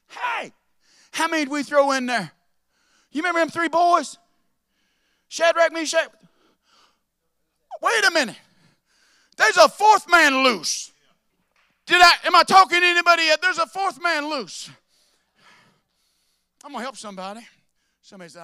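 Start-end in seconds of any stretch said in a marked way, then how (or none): 0.1-2.3 loud high call to the crowd; preacher's loud rally cry. Acted. Preacher calling out to congregation. 3.1-8.4 fiery preacher's roar; full-force preaching. Real, not acted. 9.4-10.9 loud, high and slow; slow preached climax. Real, not acted. Repeated climax line. 11.8-14.7 fiery preacher's roar; full-force preaching. Real, not acted.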